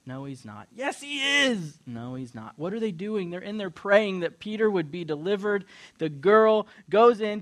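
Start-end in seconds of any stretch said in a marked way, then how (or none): 0.0-0.7 quiet, toneless-sounding denial; dismissive grown-ups. Retold or imitated. Adults dismissing the excited child. 0.7-1.8 high, drawn-out protest; slow, high-pitched childish comeback; insistent child. Retold or imitated. Child insisting against the grown-ups. 1.8-2.6 quiet, toneless-sounding denial; low-key, soft contradiction; dismissive grown-ups. Retold or imitated. Adults dismissing the excited child.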